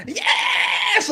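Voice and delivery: high, loud burst of agreement; emphatic agreement. Real, not acted. Agreeing with his cohost about the worst voice, a high, loud yes. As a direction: explode with a sudden yes.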